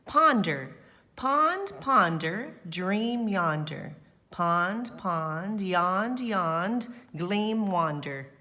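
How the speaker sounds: put-on reading the poem to the children, sing-song; poem recitation. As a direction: savor each word of the little poem. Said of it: "Let's read his poem".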